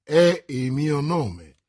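slow, firm, composed reply; calm. A narrator's reading. Musketeer answering the flustered commissioner, calm. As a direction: state it calmly and very slowly. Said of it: "disse calmo Athos".

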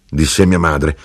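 ordinary delivery, nothing marked

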